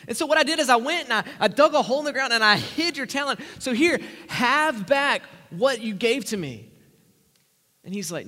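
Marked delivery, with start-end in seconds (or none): 0.1-6.6 loud, pleading servant's excuse; frightened servant. Acted. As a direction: blurt out the excuse to the master. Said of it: "He says".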